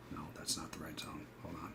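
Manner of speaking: whispered to himself, correcting a mistake; whispered aside. Real, not acted. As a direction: drop to a whisper, checking the chart. Muttering to himself at chart.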